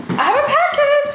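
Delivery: very high, loud sing-song; excited squeal. Acted. Vlogger squealing about her package, high and thrilled. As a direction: squeal it with glee.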